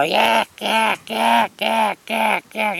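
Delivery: high repeated squawks. Retold or imitated. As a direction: squawk rhythmically like a cockatoo in flight. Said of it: "he go".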